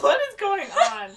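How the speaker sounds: very high, loud squeal with a laugh; mock bewilderment. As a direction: act baffled, hammed way up. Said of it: "he's like".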